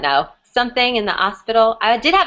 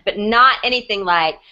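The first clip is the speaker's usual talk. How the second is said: ordinary talk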